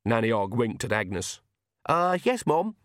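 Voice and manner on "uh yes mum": put-on high, slow eager young lad; Jason voice. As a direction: raise the pitch for a simple big son.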